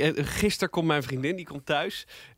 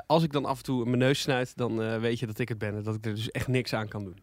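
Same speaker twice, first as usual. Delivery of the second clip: ordinary delivery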